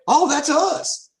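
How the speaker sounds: high, drawn-out mock surprise; pleased discovery. Clicking the next blog link and finding themselves, pleasantly surprised. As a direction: stretch out a delighted little find. Acting out a second such find.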